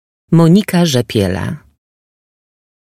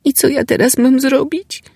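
helpless; 14 semitones higher than usual. Acted. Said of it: "zapytała bezradnie".

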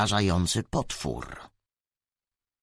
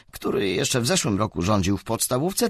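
usual speaking voice

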